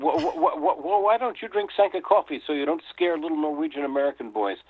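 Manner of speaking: high, panicky stammer, acted out; frightened stammer. Dangling child to nightmare hag, acted frightened stammer easing into a high-pitched plea. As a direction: stutter the start, then blurt it out like a scared child.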